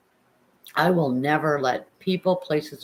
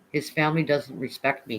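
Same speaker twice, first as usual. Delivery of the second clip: ordinary delivery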